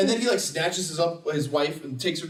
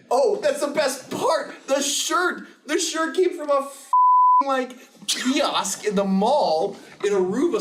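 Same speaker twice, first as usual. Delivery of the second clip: high, slow excited build; high excited outburst; excited rant; 8 semitones higher, 4 dB louder, slower than usual. Real, not acted. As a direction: rave about it high. Excited about the best part.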